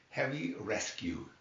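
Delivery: ordinary delivery, nothing marked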